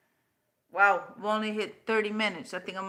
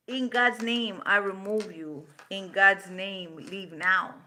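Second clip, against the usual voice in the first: firm, slow, louder command, God's stressed; commanding demo; 4 dB louder, slower than usual. Shown as a demonstration. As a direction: declare it like casting something out. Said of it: "That's how it's done".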